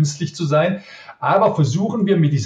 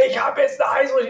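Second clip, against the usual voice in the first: much higher, whiny fake-ill excuse; mock sick caller; 10 semitones higher, faster than usual. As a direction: play the performer calling in sick. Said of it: "eine Stunde vorher anrufen und sagen".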